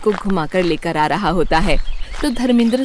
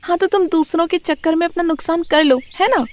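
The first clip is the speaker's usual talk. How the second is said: scolding mother; 8 semitones higher than usual. Acted. Mother scolds son sarcastically.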